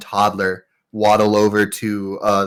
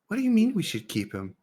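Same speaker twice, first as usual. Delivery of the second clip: put-on fast incredulous kid; 10 semitones higher, 8 dB quieter, much faster than usual. Kids arguing over keeping cat.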